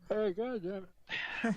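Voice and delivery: slow, high, swallowed by a yawn. Real, not acted. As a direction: curse through a yawn. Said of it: "Fuck, stop yawning".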